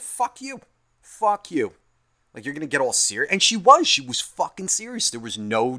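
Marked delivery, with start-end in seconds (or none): 0.0-1.7 imagined fuck-off. Retold or imitated. Imagined telling her off.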